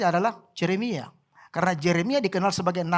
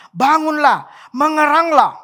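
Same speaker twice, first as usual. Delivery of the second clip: loud, raised call with held endings; prophet's cry; 4 semitones higher, 6 dB louder, slower than usual. Acted. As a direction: cry it out as an urgent summons. Acts out Jeremiah's crying prayer.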